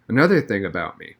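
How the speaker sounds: usual speaking voice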